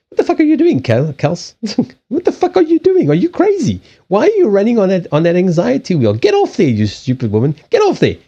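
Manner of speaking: very high, shrill-pitched telling-off of yourself; high mock yell at yourself to get off the wheel; mock self-scolding. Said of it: "You'll talk to yourself like that".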